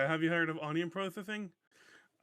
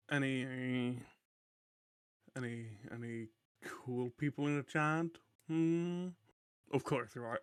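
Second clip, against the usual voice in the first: ordinary talk, nothing marked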